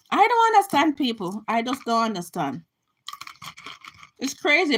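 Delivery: usual speaking voice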